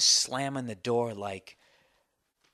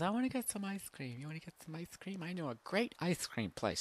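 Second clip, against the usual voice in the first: high, much softer mock girlfriend voice; 6 semitones higher, 8 dB quieter than usual. Girlfriend suggesting ice cream on the drive, soft mock voice. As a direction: coax him gently like his girlfriend.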